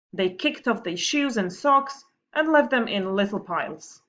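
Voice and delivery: put-on lively read-aloud voice for children; story reading. Teacher reading a funny story to her pupils, lively storytelling. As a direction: tell the story brightly to the class.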